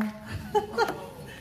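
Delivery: airy, high astonished laugh; a real, breathy laugh of amazement; breathless laugh. Real, not acted. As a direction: laugh out in disbelief. Reacting to the fiery speech.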